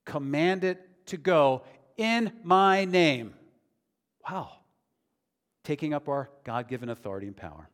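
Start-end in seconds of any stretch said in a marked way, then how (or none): 0.0-3.3 slow, loud, emphatic command; firm command. Retold or imitated. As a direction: bear down on each word with authority. Quoting Jesus' command, emphatic.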